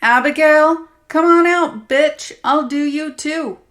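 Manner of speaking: loud, high threatening bellow; the abuser bellows a threat at the hiding friend. Acted. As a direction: roar a challenge into the house. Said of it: "He raised his voice".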